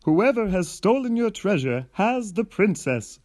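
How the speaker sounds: high, loud heroic declaration; hero declaring. Acted. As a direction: announce the deduction proudly. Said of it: "said the hero".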